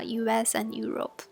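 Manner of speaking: ordinary talk, nothing marked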